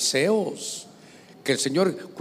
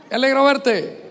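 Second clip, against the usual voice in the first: cheery greeting; 7 semitones higher, 5 dB louder than usual. Retold or imitated. Greeting the pastor across park.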